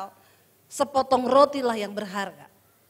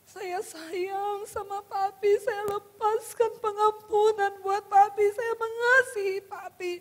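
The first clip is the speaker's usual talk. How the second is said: high, slow crying voice; tearful release, re-enacted; 5 semitones higher, slower than usual. Wife embraces husband as her father, tearfully forgiving. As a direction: draw the words out in a re-enacted wail. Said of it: "Saya mulai nangis dan buka hati".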